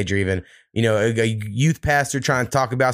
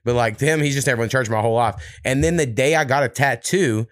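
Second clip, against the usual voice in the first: ordinary delivery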